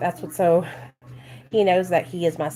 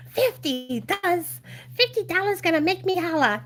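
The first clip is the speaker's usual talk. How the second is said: excited kid imitation; 8 semitones higher than usual. Parent imitating child's delight at fifty dollars, excited kid imitation. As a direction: playfully mimic a thrilled child in a high voice. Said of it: "Even Nate is excited".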